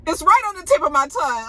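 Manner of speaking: ordinary talk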